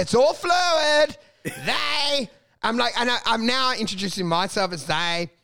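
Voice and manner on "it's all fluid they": high, drawn-out declaration; mock proclamation. Comedian jarring all gender, high slow mock proclamation.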